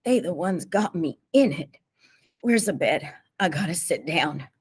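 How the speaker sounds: mumbled through a touch of sobbing; sobbing mumble. A narrator's reading. Beaten T J gropes for the bed, sobbing mumble. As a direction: mumble it, hurt and worn out. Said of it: "hardly sounding like T J, mumbled".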